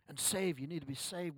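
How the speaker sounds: ordinary delivery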